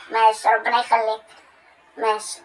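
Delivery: low and soft; reply softly, pitch dropped; quiet, low-pitched acceptance on the phone; softened, reconciled. Acted. Reconciled, winding down the call.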